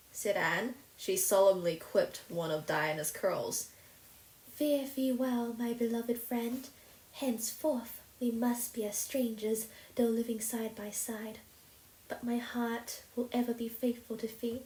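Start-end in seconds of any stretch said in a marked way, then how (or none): 4.5-14.6 solemn farewell. A narrator's reading. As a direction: bid farewell as if forever. Anne's romantic farewell speech.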